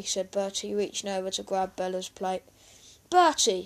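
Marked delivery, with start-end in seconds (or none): 3.1-3.6 loud, raised reprimand; sharp fatherly telling-off; snapped. A narrator's reading. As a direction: snap the name as Dad. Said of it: "snapped Dad".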